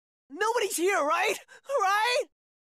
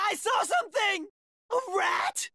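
normal talking voice

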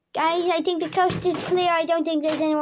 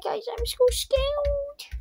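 mock scared; 8 semitones higher, 3 dB quieter, slower than usual. Hiding from pretend ghost.

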